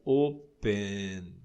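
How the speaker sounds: "oh-pennn", slow and voiced to the end; vibrating final sound. Shown as a demonstration. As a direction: open drawn out slowly on a humming N. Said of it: "veja, vibra".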